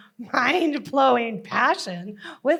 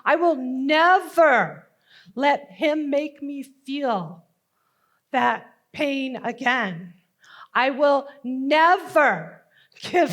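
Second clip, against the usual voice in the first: slow, emphatic vow with a loud NEVER; fierce, drawn-out resolve; determined vow; 4 dB louder, slower than usual. Acted. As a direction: swear it with all your will. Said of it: "with a will I didn't know I possessed, I vowed".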